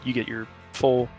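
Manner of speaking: ordinary talk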